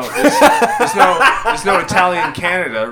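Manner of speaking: laughing. Real, not acted. Point made while laughing.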